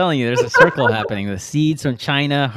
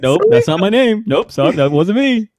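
louder, higher brush-off; mock denial; 7 semitones higher, 5 dB louder than usual. Co-host voicing the woman disowning her misspoken name, mock denial. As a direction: play-act a quick, emphatic denial.

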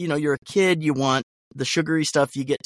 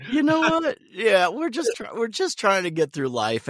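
mock indignant; 11 semitones higher than usual. Mock defensive, very high.

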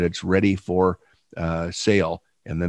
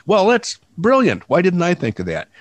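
sarcastic; 8 semitones higher than usual. Real, not acted. Mock praise of friend's obvious guess.

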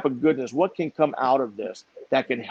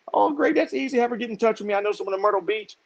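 cheery friend on phone; 7 semitones higher than usual. Retold or imitated.

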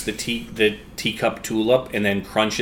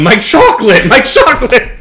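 much higher, louder hammed-up wail over the chocolate; Wonka's mock wail; 11 semitones higher, 10 dB louder than usual. Willy Wonka mourning his contaminated chocolate river, high mock wail. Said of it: "Willy Wonka's going".

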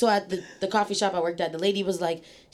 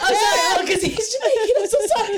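giggling, high and louder; laughing apology; 11 semitones higher, 3 dB louder than usual. Real, not acted. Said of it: "So prejudiced this one".

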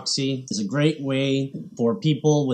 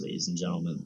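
ordinary delivery

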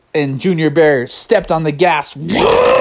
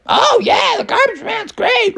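very high, squeaky chiming in; high-voiced bear. One bear agrees with the group about the garbage man, high-pitched voice. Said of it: "they're all like".

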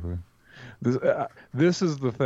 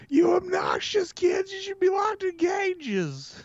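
very high, drawn-out mock shout; exaggerated high-pitched scolding impression; Gilbert Gottfried; slower than usual. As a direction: screech it slowly like an obnoxious comic scolding children. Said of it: "He said one of my favorite lines in the movie".

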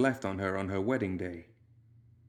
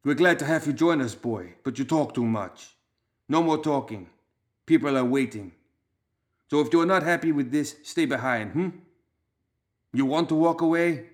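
louder, higher, commanding Balkan voice; hard Balkan accent; 5 semitones higher, 5 dB louder than usual. A narrator's reading. Leader silences the young recruit, in a hard Balkan accent. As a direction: dress him down in Balkan tones. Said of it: "his hard Balkan accent".

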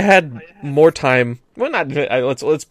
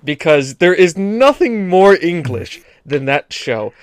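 ordinary talk

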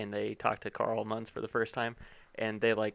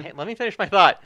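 loud, raised-pitch protest; protesting interruption; 8 semitones higher, 11 dB louder than usual. Real, not acted. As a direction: cut back in over the interrupter. Cutting off an interrupting co-host.

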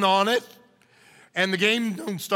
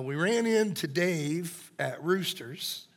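quiet reading; 4 semitones lower, 7 dB quieter, slower than usual. Retold or imitated. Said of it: "the text said".

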